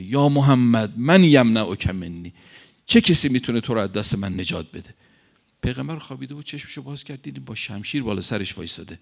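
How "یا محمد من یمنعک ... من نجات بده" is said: threatening shout. Retold or imitated. Enemy warrior looming over the Prophet, shouting a threat. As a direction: bellow the threat at the sleeping man. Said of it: "فریاد زد یک مرتبه".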